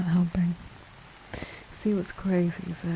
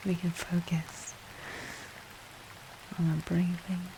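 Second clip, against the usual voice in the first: guided relaxation; 8 dB quieter than usual. Acted. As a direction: gently guide the breathing, hushed and calm.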